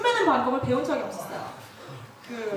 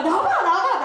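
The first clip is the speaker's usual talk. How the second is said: raised, eager call; father calling out; 6 semitones higher, 5 dB louder than usual. Retold or imitated. Father coaxing sulking daughter out, insistent loud calling. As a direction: urge the child out, loud and high.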